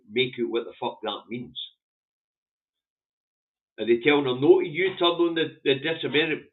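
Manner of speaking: ordinary talk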